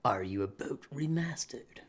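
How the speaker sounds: soft whispered reading of the title. Real, not acted. Streamer reading a game title to himself, whispered.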